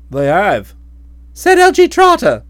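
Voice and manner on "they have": low husband voice. Acted.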